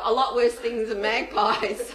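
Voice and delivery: ordinary talk, nothing marked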